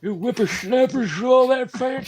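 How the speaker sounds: put-on high, crotchety old geezer; old man voice. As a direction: scold like a cranky grandpa.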